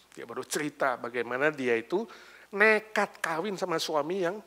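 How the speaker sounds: usual speaking voice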